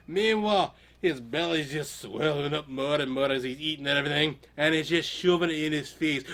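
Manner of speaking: ordinary delivery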